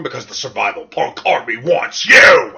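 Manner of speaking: slogan swelling into a shout; mock recruitment shout. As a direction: holler it like a hammed-up recruiting slogan.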